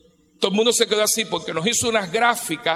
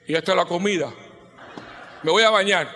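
mock husband's bark. Husband voiced coming home.

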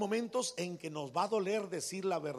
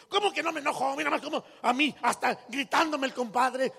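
loud, higher indignant protest; mock-angry husband; 6 semitones higher, 4 dB louder than usual. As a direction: complain hotly. Husband refuses to calm.

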